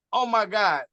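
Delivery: ordinary delivery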